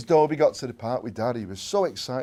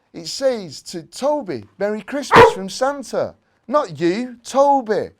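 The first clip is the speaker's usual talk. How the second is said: excited reading; 9 semitones higher, 10 dB louder, slower than usual. A narrator's reading. Jimmy reads gift tag.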